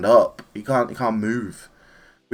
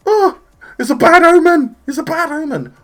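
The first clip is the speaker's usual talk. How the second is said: much higher, much louder frightened wail; panicked Beerus; 10 dB louder than usual. Retold or imitated. Beerus waking from a bad dream, wailing in high panic. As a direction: shriek it out like a spooked god of destruction. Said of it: "And he, and Beerus is like".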